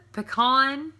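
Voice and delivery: "pecan" said "puh-KAHN". Shown as a demonstration. Vlogger reading the word list, puh-KAHN. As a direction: stress an ah in pecan's second syllable. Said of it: "it is pecan".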